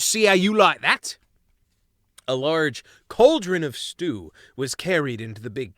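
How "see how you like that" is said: much higher, louder cook's threat; squabbling crew mouse. Acted. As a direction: wave the ladle and jeer. Crew cook threatens with ladle.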